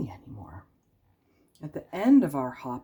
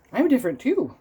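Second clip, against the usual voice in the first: chirpy hedgehog voice; 9 semitones higher, 5 dB louder than usual. Acted. Hedgehog speaks up.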